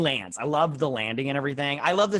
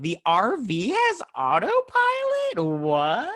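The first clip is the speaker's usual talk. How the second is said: very high, loud, incredulous squawk; slow, soaring "you can't be serious" outburst; hammed-up disbelief; 10 semitones higher, 5 dB louder, much slower than usual. Real, not acted. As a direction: stretch it out in comic astonishment. Disbelief at RV autopilot.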